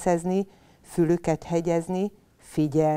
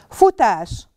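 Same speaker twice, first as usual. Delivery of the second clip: calling out; 5 semitones higher, 8 dB louder than usual. Acted. Command to run.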